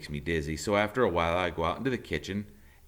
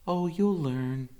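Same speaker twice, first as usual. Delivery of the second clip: mother's voice; 10 semitones higher, slower than usual. A narrator's reading. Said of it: "the Fair Gwen says".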